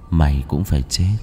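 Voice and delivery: low dark death threat; low possessed voice promising death; gloomy otherworldly voice. Acted. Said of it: "giọng âm u".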